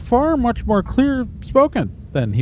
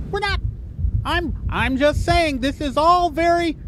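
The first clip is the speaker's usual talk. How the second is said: eager employee. Acted. GM voices the employee.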